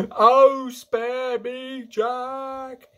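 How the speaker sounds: high, pleading yell. A narrator's reading. Dreaming giant begging Jack for mercy, yelling. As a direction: yell out, begging for your life. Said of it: "he yelled".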